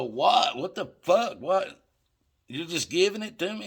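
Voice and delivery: high-pitched, incredulous questions; retold disbelief. Buyer can't believe dealer gives it free, incredulous. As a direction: re-enact being floored by a gift, voice jumping up.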